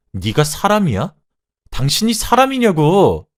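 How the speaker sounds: high, loud outraged scream; explode, pitch soaring on the accusation; furious shout. Acted. Betrayed wife to husband before throwing coffee, furious shout. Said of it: "라고 소리치며".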